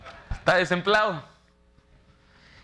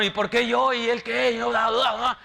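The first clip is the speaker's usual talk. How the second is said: higher, complaining employee voice; mock whiny worker; 7 semitones higher than usual. Pastor mimics a worker grumbling about his boss, mock whiny.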